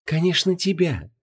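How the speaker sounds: high, sweet, smiling goddess. Acted. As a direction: beam it out sweetly. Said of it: "ещё шире улыбнулась незнакомка".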